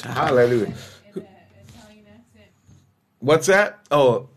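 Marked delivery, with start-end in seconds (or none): none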